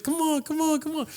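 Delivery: very high, soft wheedling; singsong begging; flirty coaxing. Retold or imitated. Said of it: "this is flirting".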